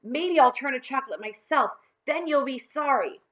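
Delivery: put-on high, loud boy's warning; aggrieved, insistent warning. A narrator's reading. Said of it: "John warned".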